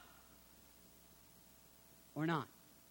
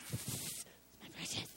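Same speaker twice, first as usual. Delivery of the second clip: unvoiced, slow, greedy whisper of "precious"; whispered ring creature; 13 dB quieter than usual. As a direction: hiss it over the treasure like the ring creature. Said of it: "It's like the ring".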